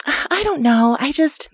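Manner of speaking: a hint of mom, hesitant; impression of mom. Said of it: "this is my impression of my mom".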